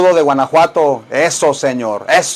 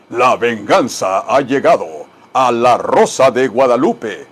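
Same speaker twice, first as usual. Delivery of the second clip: lightly voiced soap announcer; a hint of TV trailer voice; mock announcer; 5 semitones lower than usual. Teacher mimicking a TV show announcement for students.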